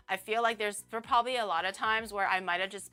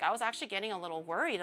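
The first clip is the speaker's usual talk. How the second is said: ordinary talk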